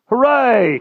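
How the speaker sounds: high loud cheer; a boy's whoop of joy. A narrator's reading. Kay cheering the London trip, loud and high. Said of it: "cried Kay".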